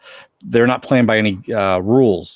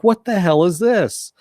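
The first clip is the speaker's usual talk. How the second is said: confused outburst; 9 semitones higher, 3 dB louder, slower than usual. Retold or imitated. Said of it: "It's like".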